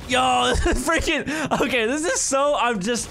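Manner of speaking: high, thrilled, laughing at first; giddy excitement. Real, not acted. Player gushing over a cool level, giddy.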